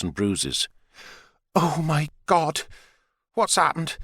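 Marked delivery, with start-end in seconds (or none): 1.5-4.0 higher, slow, alarmed mother; fussing mother. A narrator's reading. Mother sees her daughter's cuts, slow and alarmed. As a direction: fuss over her hurt daughter. Narrator voices Sarah's mother.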